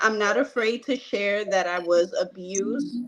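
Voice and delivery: ordinary delivery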